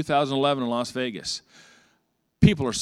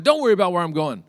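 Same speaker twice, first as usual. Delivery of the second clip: testy retort; 5 semitones higher, 6 dB louder, faster than usual. Retold or imitated. Acted quarrel while packing.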